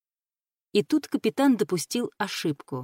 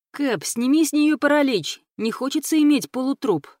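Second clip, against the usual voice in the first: higher-pitched pirate pleading with his boss; eager pirate; 6 semitones higher, 4 dB louder than usual. A narrator's reading. Pirate asks captain to free the paralyzed captive, higher and eager.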